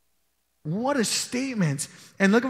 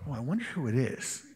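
muttered aside; 7 semitones lower, 8 dB quieter than usual. Retold or imitated. Imagined disciple suspecting someone else.

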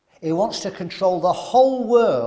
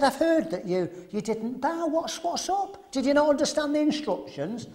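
mock-innocent questioning, pitch climbing higher; fake friendly smile; 8 semitones higher than usual. A furious king asking the three who didn't bow, with a mock friendly smile. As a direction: hide the fury behind a sugary smile. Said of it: "but he put a smile on".

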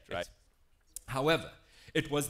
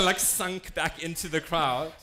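usual speaking voice

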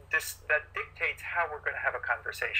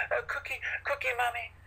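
higher, lightly voiced whine; whining toddler; 9 semitones higher than usual. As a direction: beg like a two-year-old. Toddler crying for cookie.

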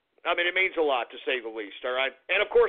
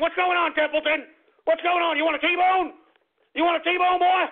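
high, excitable pet voice; excited dog talk; 8 semitones higher than usual. Real, not acted. Host offering his dog a T-bone, excited. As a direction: rile up the dog with high-pitched questions.

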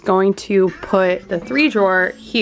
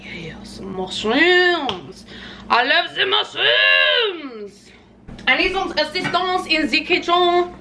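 drawn-out, hammed-up French accent; mock French accent; 6 semitones higher, slower than usual. Cook raving about mushrooms and wanting help, mock French accent. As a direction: swoon and call in a put-on French accent. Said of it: "kind of started off as French".

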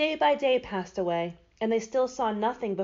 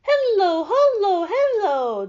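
loud, high, drawn-out chant; ringing high spell-casting call; magic incantation; 11 semitones higher, 10 dB louder, slower than usual. A narrator's reading. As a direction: call out each word of the spell. Dorothy recites the magic charm.

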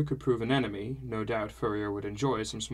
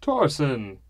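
a raised, higher call of the name; louder, delighted cry of greeting; 4 semitones higher, 6 dB louder than usual. A narrator's reading. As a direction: call out to a son across the room. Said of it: "he cried out again".

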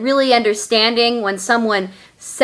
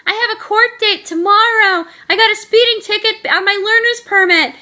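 imitating a fake friend in a high, put-on voice; phony friend; 11 semitones higher, 3 dB louder than usual. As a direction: make the excuse sound fake, pitched way up. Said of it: "she gave me a very phony hello".

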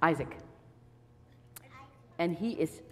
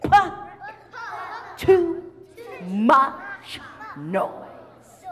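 loud, higher cranky shout; grumpy shout; 6 semitones higher, 9 dB louder, much slower than usual. Acted. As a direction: bellow it with the kids. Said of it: "say it with me".

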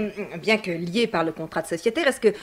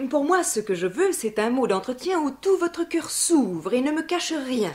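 higher-pitched, declaimed alexandrines; theatrical verse, pitched up; classical verse scene; 5 semitones higher than usual. Acted. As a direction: play the lady seeking a frank talk. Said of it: "nous en jouer une scène".

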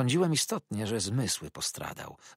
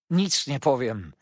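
raised, slow cry of despair; desperate cry; 6 semitones higher, 7 dB louder than usual. Acted. The old man refuses to speak under Uriah's threat, despairing. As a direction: call it out in despair, higher and louder. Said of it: "zawołał z rozpaczą starzec".

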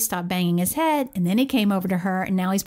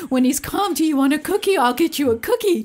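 higher, louder sing-song offer of a cookie; coaxing mom; 7 semitones higher, 4 dB louder than usual. Retold or imitated. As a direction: imitate a mom bribing her child sweetly.

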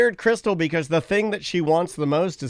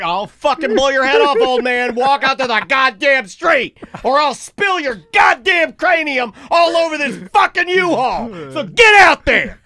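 loud, raised, drawn-out angry shouting; mock gunman threats; 5 semitones higher, 5 dB louder than usual. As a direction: build to a bellowed command. Acted gunman threatening old man.

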